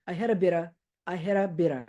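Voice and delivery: I had a bira with had a and bit of joined; linked syllables. Shown as a demonstration. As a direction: say I had a bira as one smooth chunk. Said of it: "had dan a itu disambung".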